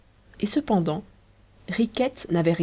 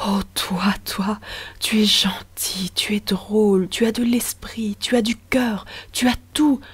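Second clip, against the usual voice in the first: murmured endearments; 3 semitones higher, slower than usual. Acted. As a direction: breathe each pet name against her lips. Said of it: "qui lui murmurait sur les lèvres".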